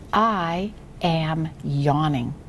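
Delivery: slow, word-by-word read-aloud; each word drawn out and separated; model reading. Shown as a demonstration. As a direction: read it very slowly for a beginner to echo. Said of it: "I read first, and then you read after me".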